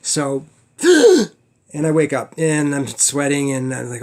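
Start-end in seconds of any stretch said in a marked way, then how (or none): 0.8-1.3 loud, high gasp for air; sharp gasp. Retold or imitated. Waking from a drowning dream with a loud gasp for air. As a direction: gulp air like you just broke the surface.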